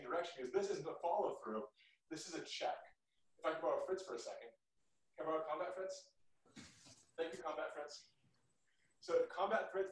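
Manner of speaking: distant, faint instructor voice; speaking away from the microphone; far from the mic. Real, not acted. Quieter explanation far from mic.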